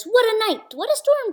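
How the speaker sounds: high, loud excited critter voice; excited exclamation. Acted. Mole and Wren arriving, high and loud exclaiming. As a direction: exclaim at the storm. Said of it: "Mole mutters".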